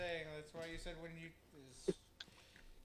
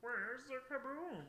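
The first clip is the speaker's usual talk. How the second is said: Marvin the Martian voice; 8 semitones higher, 3 dB quieter, slower than usual. Retold or imitated.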